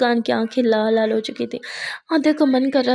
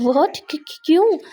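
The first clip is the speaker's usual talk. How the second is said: shocked stammer; 5 semitones higher, 4 dB louder than usual. Acted. Muskan shocked at leaving news.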